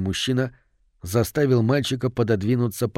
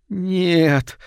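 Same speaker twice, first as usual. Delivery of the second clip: high, drawn-out moaned protest; woman's moan; 7 semitones higher than usual. A narrator's reading. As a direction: moan it out, pitched well up. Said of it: "простонала мать". (высокий протяжный стон)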